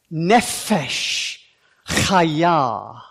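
Nefesh chaya with a spitting throaty ch; over enunciating. Shown as a demonstration. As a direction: project Nefesh chaya dramatically. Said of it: "as dramatically as you possibly can".

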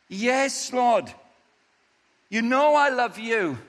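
high, loud, earnest insisting; anxious Peter. Acted. Peter answering Jesus, high and loud.

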